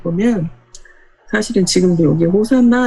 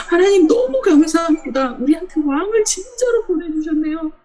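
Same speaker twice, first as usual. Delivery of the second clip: joyful praise; 8 semitones higher than usual. Retold or imitated. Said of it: "하나님을 찬양하고".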